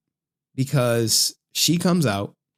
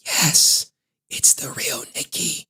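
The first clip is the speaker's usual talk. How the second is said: breathy secret whisper; 3 semitones higher, slower than usual. Retold or imitated. Real Nikki revealing herself in a whisper.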